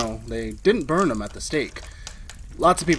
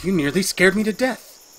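higher, louder shaken protest; shaken outburst; 7 semitones higher, 5 dB louder than usual. Acted. Man telling the woman she startled him, shaken. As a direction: let the fright spill out.

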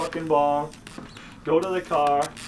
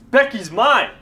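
loud, raised, emphatic anger; possessive anger; 5 semitones higher, 5 dB louder than usual. Acted. Jealous man claims Becky from his rival, angry. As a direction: lay down the law angrily.

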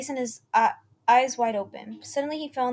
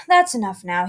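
bored remark; 8 dB louder than usual. A narrator's reading. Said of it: "he commented, bored".

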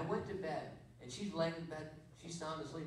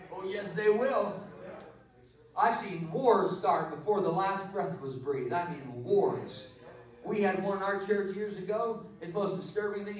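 loud, slow, fervent preacher voice; fiery preaching; 2 semitones higher, 8 dB louder, slower than usual. Real, not acted. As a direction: preach it out to the whole church. Preaches at full force.

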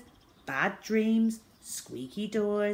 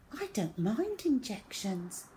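put-on higher, softer little boy; goody-goody child; 4 semitones higher, 4 dB quieter than usual. A narrator's reading. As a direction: pipe up virtuously, lightly. Perfect child shows off virtue.

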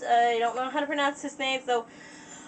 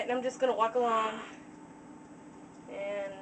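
ordinary talk

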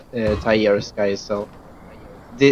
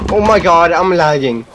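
loud excited exclaim; 4 semitones higher, 6 dB louder than usual. Real, not acted. Game lagging, loud.

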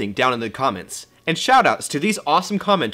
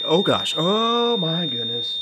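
melodramatic fading; slower than usual. Acted. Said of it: "So melodramatic".

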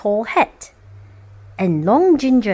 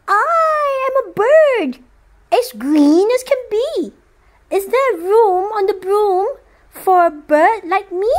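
a chirpy bird asking politely for a ride; green bird; 8 semitones higher than usual. Acted. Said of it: "then said".

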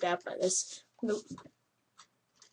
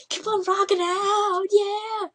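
high, loud hammed-up rock cheer; mock rocker cheer; 11 semitones higher, 8 dB louder than usual. Gamer voicing a dancing character, mock rock cheer. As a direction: cheer her on like a rock fan. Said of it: "She's like".

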